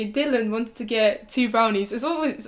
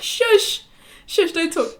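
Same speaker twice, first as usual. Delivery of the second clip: laughing shush; 7 semitones higher, 4 dB louder, much slower than usual. Retold or imitated. Hushing friend next to worker.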